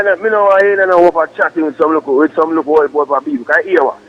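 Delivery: usual speaking voice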